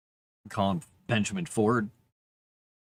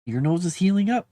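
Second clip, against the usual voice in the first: higher, rising reassurance to a pet; pet talk; 5 semitones higher than usual. Real, not acted. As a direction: coo it upward, coaxing the buddy. Repeated, coaxing the pet.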